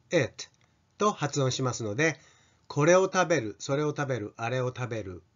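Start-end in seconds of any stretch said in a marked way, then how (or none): 0.1-0.5 "eat" said as "it", lax i near e; tongue-lowered short i. Shown as a demonstration. Teacher contrasting the pronoun, "eat" pronounced with lowered tongue as "it". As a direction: drop the tongue so "eat" becomes "it". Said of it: "舌を下げて".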